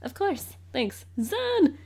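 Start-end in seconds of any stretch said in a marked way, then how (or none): 1.2-1.8 a high, bright call of his name; excited call. Acted. Spotting Zan and greeting him with an excited call.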